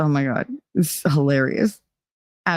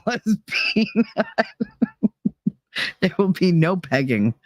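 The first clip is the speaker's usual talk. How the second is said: normal talking voice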